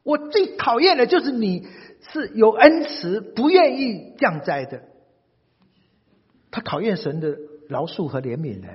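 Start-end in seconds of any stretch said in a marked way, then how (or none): 0.0-4.8 high, loud furious complaint to God; indignant prophet blowing up, slowing on the last words; angry outburst. Acted. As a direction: throw a tantrum at God, voice cracking high. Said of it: "大发脾气".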